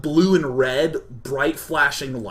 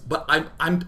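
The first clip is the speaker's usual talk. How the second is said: ordinary delivery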